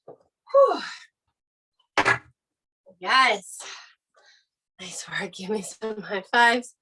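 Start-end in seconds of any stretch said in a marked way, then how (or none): 0.1-0.8 high, loud blown-out whew after the last rep; a real gasp of relief after hard effort; winded whew. Real, not acted. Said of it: "I am out of breath". 4.8-5.3 soft whisper of praise; a quick whispered well done to the class. Real, not acted. Coach whispers praise after set.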